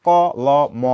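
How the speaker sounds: ক, ল, ম as three separate sounds, each with its own vowel; ক... ল... ম, broken apart sound by sound; letters said separately. Shown as a demonstration. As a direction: spell it out ko, lo, mo. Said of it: "এরকম করে উচ্চারণ করো না".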